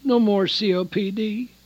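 normal talking voice

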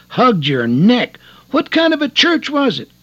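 high, loud incredulous squawk of a wife; wife aghast that deacons hugged him, rising loud and high; shocked disbelief. Retold or imitated. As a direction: voice leaping up in scandalised surprise.

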